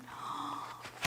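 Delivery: soft gasp of surprise; sharp intake in wonder; amazed gasp. Real, not acted. Sees the signed book.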